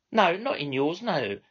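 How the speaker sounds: high, sugary mock politeness; butter him up while mocking him; insincere courtesy. Controller to phone customer, courtesy that amounts to mockery. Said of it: "she continued into the phone".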